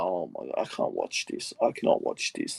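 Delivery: whispered, can't bear to look; despairing whisper. Retold or imitated. Carlton fan watching Richmond take the lead, in a despairing whisper. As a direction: hush it like you're hiding your eyes.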